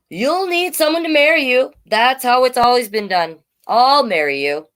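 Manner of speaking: high, loud know-it-all cricket; bossy cricket. Acted. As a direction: lecture them like a fussy cricket. Cricket voiced in story.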